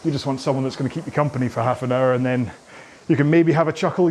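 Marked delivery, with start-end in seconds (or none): none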